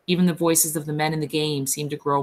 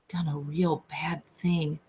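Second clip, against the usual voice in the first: slow, soft frightened murmur; hushed; 7 dB quieter, slower than usual. A narrator's reading. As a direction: mutter it, slow and scared. Lenny frightened after killing.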